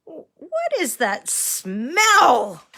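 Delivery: slow puzzled question; drawn-out puzzled asking; puzzled man. Acted. Man notices the smell.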